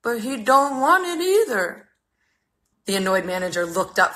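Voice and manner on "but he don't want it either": much higher, slow whimpering complaint; weepy voice. Acted. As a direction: report it like you're about to cry. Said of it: "reporting in a weepy voice".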